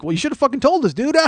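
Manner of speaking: high ribbing voice breaking into cackles; imitated laughing. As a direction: mimic friends cracking up as they scold. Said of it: "They're laughing".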